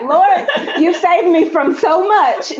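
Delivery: laughing. Retold or imitated. Joking relief, laugh heard.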